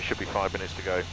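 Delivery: ordinary talk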